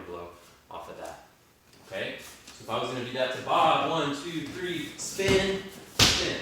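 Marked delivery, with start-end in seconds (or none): 3.9-6.4 boxing coach calling combos. Shown as a demonstration. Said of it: "demonstrating combo on partner".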